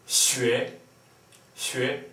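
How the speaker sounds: xue steigend im zweiten Ton; second tone. Shown as a demonstration. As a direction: xue nach oben ziehen. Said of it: "Second tone".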